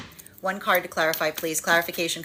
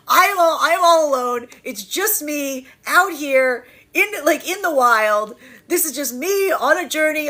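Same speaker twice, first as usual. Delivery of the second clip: higher and louder, hammed-up lone wanderer; mock dramatic self-pity; 7 semitones higher, 5 dB louder than usual. Energy in separation claiming it did all the work alone, hammed-up and louder. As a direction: play the martyr on a solo journey.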